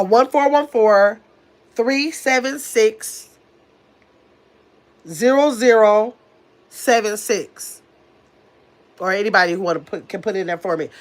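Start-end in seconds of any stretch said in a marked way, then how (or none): 0.0-7.8 slow, each digit spaced out; careful slow dictation of a phone number. Real, not acted. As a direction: read the digits out slowly so chat can type them. Giving phone number to chat.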